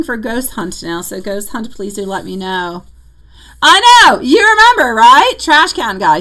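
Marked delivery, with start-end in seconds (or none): 3.6-6.2 excited. Real, not acted. Excited about the Trash Can Guy.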